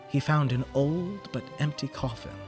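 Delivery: ordinary delivery, nothing marked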